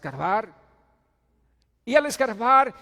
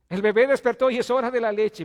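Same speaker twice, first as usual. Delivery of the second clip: louder, higher wake-up call; loud preaching call; 7 semitones higher, 12 dB louder than usual. Acted. Preacher calling the congregation to the word, loud and high. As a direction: announce it like an alarm going off.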